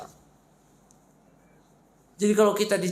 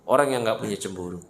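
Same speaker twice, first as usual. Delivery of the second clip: normal talking voice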